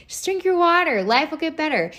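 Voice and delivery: high, sing-song mock coach voice; mock cheerful advice. Host mocking her own water advice, high mock-cheerful. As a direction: chirp it like your own cheesy catchphrase. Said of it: "That's all I say".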